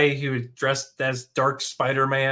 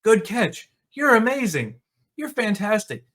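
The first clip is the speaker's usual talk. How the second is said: higher, gushing mock praise; 7 semitones higher than usual. Coworker gushing over the heroine, in mock praise. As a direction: gush over her like a fawning coworker. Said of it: "Their quote was".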